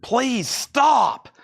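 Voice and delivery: hammed-up comic begging, rising to a high cry; Chris Farley. Seller begging for the love of God, hammed-up impression. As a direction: stretch it out, climb high on the last words. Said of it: "Chris Farley voice".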